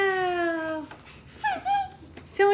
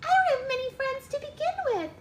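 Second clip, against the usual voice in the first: high fairy voice; 7 semitones higher, slower than usual. Acted. Fairy Trix keeps crying.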